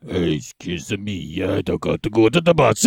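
slow, low put-on Albanian accent. Shown as a demonstration. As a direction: drag it out in a deep mock Albanian accent. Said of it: "I can do an Albanian".

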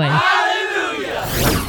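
high, slow jubilant cry; joyful shout. Acted. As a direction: belt it out in celebration. Promo shout before interview.

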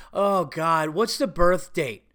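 slow exasperated mimicry; mock her dragging out the question; impatient receptionist. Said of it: "in an impatient asshole way".

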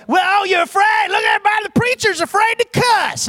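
high, loud schoolyard taunt; imitated bully hollering; mocking jeer. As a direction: jeer it for everyone around to hear. Imitates jeering classmates.

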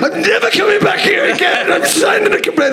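very high, indignant yelled threat; furious complaint. Retold or imitated. Angry punter threatening complaint to comedian, very high mock shout. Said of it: "he goes".

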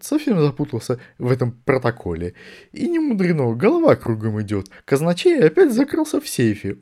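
high, hurried flustered mutter; muttering Archchancellor. A narrator's reading. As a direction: ramble to yourself, flustered. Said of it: "Продолжая бормотать себе под нос". (бормочет себе под нос)

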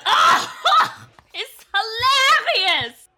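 loud fake laugh, slapping the bar; mock laughing exclamation. Mina laughs loudly at the barkeep's joke. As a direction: bursts out with a hammed-up laugh. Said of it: "slaps the bar like".

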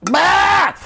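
high loud bark of disgust; reacting to the creepy uncle's line, a loud disgusted bah. Real, not acted. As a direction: blurt out a revolted bah.

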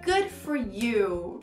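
slow, drawn-out fake congratulation. Shown as a demonstration. Teacher showing learners how it sounds sarcastic, fake. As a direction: stretch every word, insincerely pleased. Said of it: "Só falando assim já tá meio fake".

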